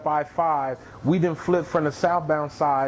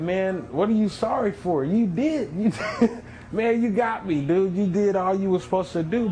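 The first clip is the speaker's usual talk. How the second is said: higher, emphatic, half laughing; grateful and amazed; 5 semitones higher than usual. Retold or imitated. To the rescuer who saved him, amazed and laughing. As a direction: thank him with a laugh in disbelief. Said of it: "I said".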